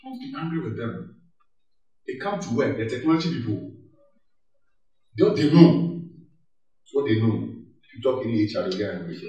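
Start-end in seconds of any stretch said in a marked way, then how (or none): none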